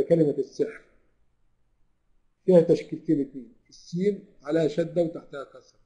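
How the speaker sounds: usual speaking voice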